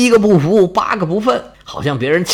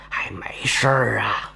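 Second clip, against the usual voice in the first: whispered reassurance, slowed down; soothing an anxious general in a whisper; hushed coaxing; 8 semitones lower, 9 dB quieter, slower than usual. Acted. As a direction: whisper him calm, as if no one should hear. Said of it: "还在一劲儿劝他".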